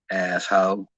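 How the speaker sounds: "Asshole" said the local way, ending in a plain "hoe"; local pronunciation. Shown as a demonstration. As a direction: say "Asshole" as ass-hoe, no L at the end. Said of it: "That's how they say it where I'm from".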